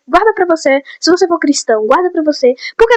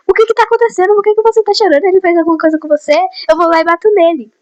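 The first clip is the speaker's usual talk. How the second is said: much higher, urgent protective questions; mock-frantic friend ready to fight for her; worried friend; 6 semitones higher, faster than usual. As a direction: friend Alisson pressing the crying girl, mock-frantic and protective. Worried friend confronting crying girl.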